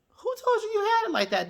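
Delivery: a high, sassy mock of her own question. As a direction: jump way up high for the mocking repeat. Mock repeat at Destiny.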